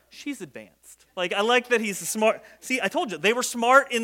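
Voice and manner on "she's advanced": suddenly low and soft; mock-discreet low aside; tactful hush. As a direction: drop right down to say it delicately. Tactful contrast after loud blurt.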